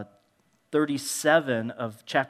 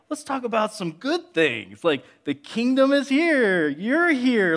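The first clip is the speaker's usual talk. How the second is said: much higher, louder, bubbly; eager cheerleading; 10 semitones higher, 5 dB louder than usual. Retold or imitated. Disciples cheering up a heavy Jesus, high and bubbly. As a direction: pump him up with good news. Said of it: "They're like".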